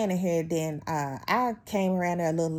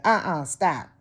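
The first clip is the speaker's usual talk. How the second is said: louder sharp shutdown; firm stop; 6 dB louder, slower than usual. Retold or imitated. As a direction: shut it down firmly. Telling coworker off.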